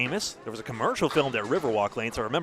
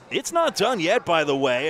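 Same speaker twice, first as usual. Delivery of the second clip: excited; 3 semitones higher, 6 dB louder than usual. Real, not acted. Commentator reacts to a live shot.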